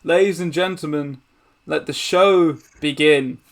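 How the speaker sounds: big show-opening announcement; megaphone announcer. Acted. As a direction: proclaim it to a crowd as showman. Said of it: "he takes a megaphone and goes".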